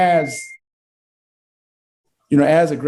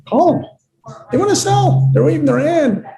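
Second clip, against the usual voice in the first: high, louder push to call them; emphatic urging; 13 semitones higher, 3 dB louder than usual. Real, not acted. Al urging agents to call sellers, high and louder. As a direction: fire up the agents.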